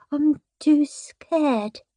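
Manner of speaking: high, soft, very slow whimper. A narrator's reading. Peter balks at the chest, soft high whimper. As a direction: whimper it slowly like a frightened little boy. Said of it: "he whimpered".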